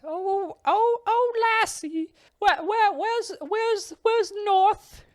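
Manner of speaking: put-on very high, quavering old man voice. Lost old man in a kimono asking the walker the way, high quavering voice. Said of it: "He goes".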